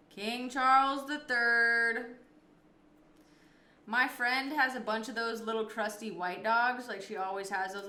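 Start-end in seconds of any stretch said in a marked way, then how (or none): 0.1-2.2 slow, louder royal announcement; King Charles the Third, slow and grand; grand, drawn-out title. Real, not acted. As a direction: stretch it out like a herald. Answers with the full royal title.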